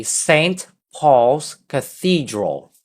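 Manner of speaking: "Saint Paul's Cathedral" slow, stress on "Saint", falling at the end; slow teacher model phrase; model for repeating. Shown as a demonstration. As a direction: say it slowly for the class to echo. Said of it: "read after me".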